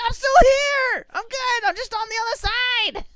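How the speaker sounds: very high, loud comic holler; reassuring shout. Acted. Teammate popping up on other side, triumphant shout.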